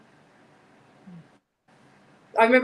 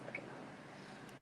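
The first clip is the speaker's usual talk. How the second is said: whispering; 26 dB quieter, much slower than usual. Real, not acted. Worried about sick cat.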